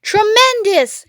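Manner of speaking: high, loud, slow shout; delighted shout. Acted. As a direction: boom it with delight. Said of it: "shouted Badger".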